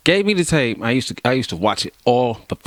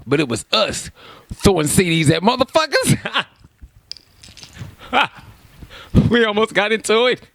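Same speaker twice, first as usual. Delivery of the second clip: laughing; 8 semitones higher than usual. Real, not acted. Laughing retelling of bar fight.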